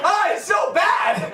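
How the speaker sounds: high, drawn-out cry of agony; real pained yell. Real, not acted. Comic shocked in front of strangers, wailing in pain. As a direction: wail slowly through the jolt.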